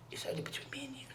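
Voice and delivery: put-on hushed whisper, like a child sharing a secret. A sick girl whispers a secret to her friend, hushed. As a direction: breathe it as a secret only for one listener.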